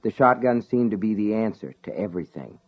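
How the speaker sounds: plain reading voice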